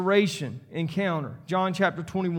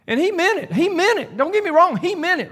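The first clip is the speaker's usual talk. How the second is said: insistent preaching; 10 semitones higher, 6 dB louder than usual. Real, not acted. Preacher pressing his point on the congregation, high loud insistence. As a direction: pitch shoots up and loud, hammering meant.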